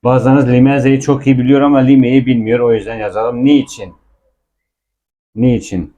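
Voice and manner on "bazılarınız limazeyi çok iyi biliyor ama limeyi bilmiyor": ordinary talk, nothing marked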